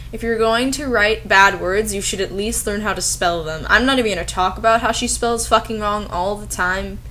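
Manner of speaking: ordinary talk, nothing marked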